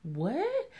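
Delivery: high, incredulous what; stunned what. Retold or imitated. Reacting to his cute remark, high disbelieving what. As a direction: ask it in disbelief. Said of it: "And I'm like".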